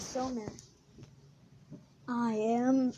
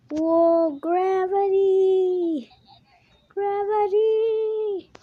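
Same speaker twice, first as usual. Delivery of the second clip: drawn-out, much higher and louder yell, half sung; excited shout; 10 semitones higher, 11 dB louder, much slower than usual. Real, not acted. Gamer thrilled by gravity power-up, slow gleeful shout. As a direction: sing out the word in pure glee.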